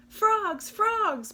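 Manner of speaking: shouting. Acted. Possum shouting to the frogs at the pond. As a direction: yell across the pond. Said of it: "Possum shouted".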